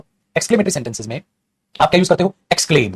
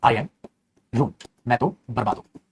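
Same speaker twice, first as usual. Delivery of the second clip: soft lament, lowered voice; sad alas; 6 semitones lower, 8 dB quieter, slower than usual. Shown as a demonstration. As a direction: lower and softer, mourning. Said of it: "कोई ना कोई expression तो देना पड़ेगा".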